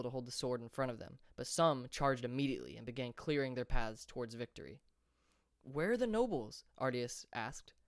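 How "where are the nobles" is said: higher, alarmed question. A narrator's reading. Ardias asking friends about the nobles, alarmed and higher. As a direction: look around in worry.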